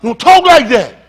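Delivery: barked high and loud in another man's voice; mocking, commanding shout; imitated harsh command. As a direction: slam it down like a master silencing a slave. Voicing oppressors silencing slaves.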